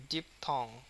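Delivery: "Diphthong", strong first half and weaker second; stress on DIP. Shown as a demonstration. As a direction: press the first syllable of "diphthong". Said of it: "ডিপের উপরে প্রচুর stress".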